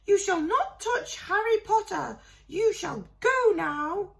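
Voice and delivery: high, loud fierce elf voice, slowing at the end; a tiny elf standing up to a wizard. A narrator's reading. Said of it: "he said fiercely".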